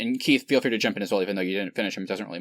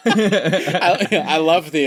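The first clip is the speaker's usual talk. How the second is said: laughing; 6 semitones higher, 6 dB louder, much slower than usual. Real, not acted. Talking through laughter.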